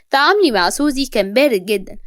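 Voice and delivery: usual speaking voice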